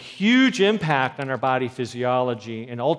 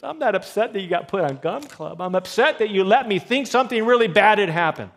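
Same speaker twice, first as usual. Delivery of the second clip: exasperated mother; 8 semitones higher, 7 dB louder than usual. Retold or imitated. Mother scolding daughter over gum.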